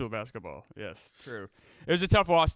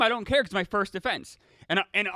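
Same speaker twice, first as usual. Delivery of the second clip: ordinary delivery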